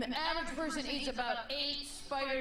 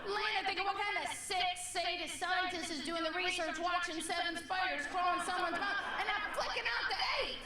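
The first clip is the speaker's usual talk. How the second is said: higher, worked-up comic rant; higher, exasperated rant; 8 semitones higher, 11 dB louder than usual. Acted. Comic rant to the audience about the spider fact, higher and exasperated. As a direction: wind yourself up, higher and higher.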